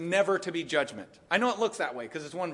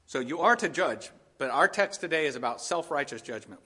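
ordinary talk

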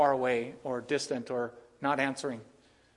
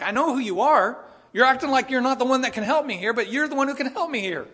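insistent plea; 8 semitones higher, 6 dB louder than usual. Acted. Woman insisting Jesus can help.

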